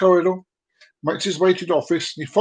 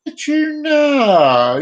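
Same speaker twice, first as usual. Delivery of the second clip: long drawn-out title call; hammed-up announcer; 7 semitones higher, 4 dB louder, much slower than usual. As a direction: sing out the title like a show host. Announcing show segment title, drawn out.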